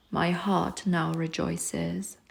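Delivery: plain reading voice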